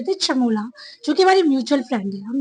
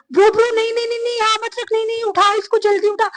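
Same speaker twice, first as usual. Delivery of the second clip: imitated yelling; 8 semitones higher, faster than usual. Roommate yelling at her to take the pizza off the bed. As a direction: shout at her to move the pizza now. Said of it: "she started yelling at me".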